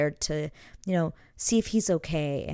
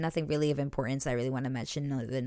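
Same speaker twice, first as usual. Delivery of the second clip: ordinary talk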